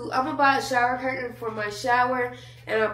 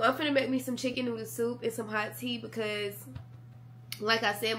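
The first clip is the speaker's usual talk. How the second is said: ordinary delivery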